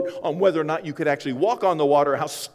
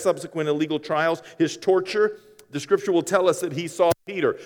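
ordinary talk

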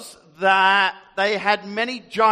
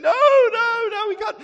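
frightened soldiers; 14 semitones higher than usual. Acted. Army refusing, voiced by teacher.

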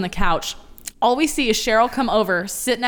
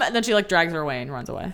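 ordinary delivery

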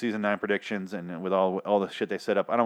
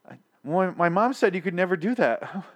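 higher-pitched, gushing disbelief; mock touched partner; 6 semitones higher than usual. Partner hearing boyfriend got sober, mock touched. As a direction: melt as if won over.

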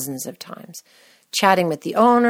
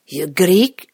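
lightly acted Greek-accented waiter; louder question in a Greek accent; suspicious waiter with a Greek accent; thick Greek accent; 7 dB louder than usual. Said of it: "with an accent thick as grape musk syrup".

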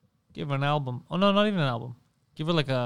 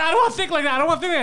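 mock distress; 10 semitones higher, 4 dB louder, much faster than usual. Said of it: "He's like".

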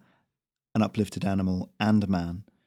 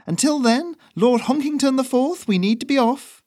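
very high, loud mock-grand address; teasing the goose with a grand title; Ferrino's voice; 6 dB louder than usual. Messenger mock-grandly addresses his goose, very high.

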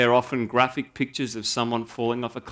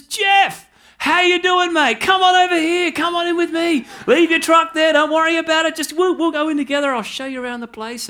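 much higher, louder, friendly put-on voice; cheery David Hurley voice; 5 dB louder than usual. Governor General inviting the removal guy inside, high hearty put-on voice. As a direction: pitch way up, hearty and welcoming.

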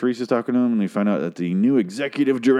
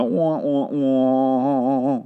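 sung mock wah wah wah; sad trombone; 5 semitones higher, much slower than usual. Mocking a cheesy plot twist with a sad trombone wah wah. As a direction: hum the sad trombone sting. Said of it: "They might as well have done, like".

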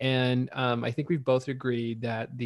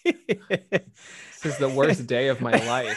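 laughing; 4 dB louder, slower than usual. Real, not acted. Laughing at a bad day.

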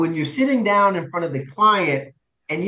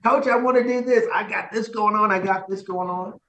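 higher-pitched imitation of a client; mock pleading client, voice lifted; lightly voiced client; 7 semitones higher than usual. Client pitching his plans to the coach, lightly imitated. Acting out client's eager excuses.

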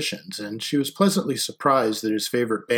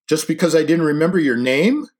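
loud, raised, disbelieving question; incredulous; 6 semitones higher, 7 dB louder than usual. A narrator's reading. He learns why she insulted him, incredulous. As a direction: ask it loudly in disbelief, climbing at the end. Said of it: "He was incredulous".

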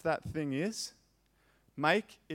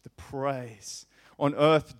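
usual speaking voice

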